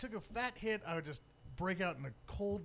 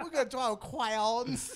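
lisping voice; 4 semitones higher, 7 dB louder, much slower than usual. Retold or imitated. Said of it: "This guy's like".

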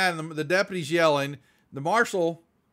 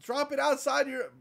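higher mock scold; 10 semitones higher than usual. Deputy scolds the player, high mock scolding. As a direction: snap it like a fussy deputy. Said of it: "and he's like".

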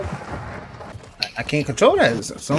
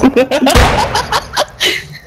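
loud laugh; 13 semitones higher, 4 dB louder than usual. Real, not acted. Laughing at getting stuck.

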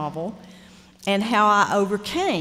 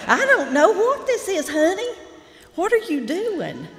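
imitating Lee Smith; 11 semitones higher, 6 dB louder than usual. Writing teacher teasing her student over a story, high loud imitation. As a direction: send it way up and loud like a blunt teacher. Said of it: "Y'all know Lee".

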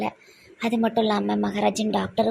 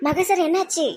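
high-pitched worried cry; raised, distressed voice; alarmed concern; 6 semitones higher, 3 dB louder than usual. Acted. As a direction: fuss in panic over an injured friend. Finds him hurt and bleeding.

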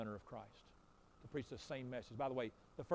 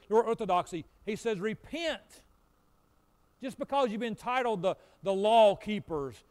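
louder fiery rebuke; preaching at full force; 5 semitones higher, 10 dB louder than usual. Acted. John the Baptist rebuking Pharisees, fiery preaching. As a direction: thunder it from the pulpit.

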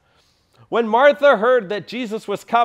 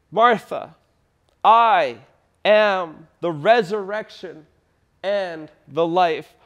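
louder, slow and weighty on "I am"; solemn proclamation; 3 semitones lower, 4 dB louder, slower than usual. Acted. Jesus declaring himself to grieving Martha, solemn and slow. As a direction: pause after "I" and let it land.

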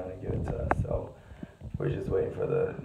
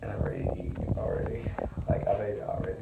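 whispering; 3 semitones lower, faster than usual. Real, not acted. Hiding in bathroom at 3am.

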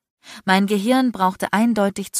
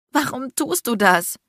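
higher, hurt, pleading question; tear-choked; 7 semitones higher than usual. A narrator's reading. Hurt woman asks why he torments her, tear-choked. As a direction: ask it fighting back tears. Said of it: "krächzte ich tränenerstickt".

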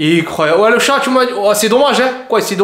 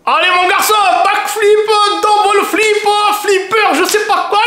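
cheering at the TV; yelling encouragement at the screen; excited cheering; 11 semitones higher than usual. Acted. Husband cheering at TV sport.

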